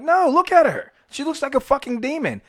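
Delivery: high, urgent retold protest; worked-up, high insistence; alarmed protest. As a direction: plead with the cop in disbelief, pitch way up. Pleading his case to the cop.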